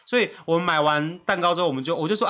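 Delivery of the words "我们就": ordinary delivery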